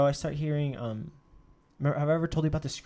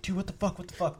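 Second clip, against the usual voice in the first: higher-pitched, mildly panicked retelling; retold freak-out; 5 semitones higher than usual. Storyteller running inside to his friends, freaked out. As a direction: retell a freaked-out outburst without raising the volume. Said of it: "freaked out".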